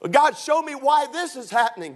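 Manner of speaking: high-pitched mock insistence; demanding plea to God. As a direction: act out a believer pressing God for answers. Voices a believer demanding answers from God.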